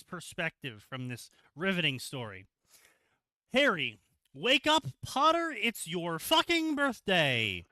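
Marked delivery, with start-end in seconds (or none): 3.5-7.6 high, loud, slow mocking shout; mock British wake-up yell. Mocking a cousin yelling Harry awake for his birthday. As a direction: ham up a rude wake-up call. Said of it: "said no British child ever".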